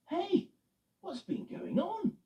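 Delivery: cheerful high truck voice; Eddie the truck. Acted. Eddie the truck asking Loretta what happened, puzzled. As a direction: play Eddie bright and puzzled.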